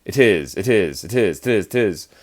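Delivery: sped-up repetition. Shown as a demonstration. Teacher to class, speeding up it is to show 'tis. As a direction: run it is together quickly for students. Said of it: "If you just say it really fast".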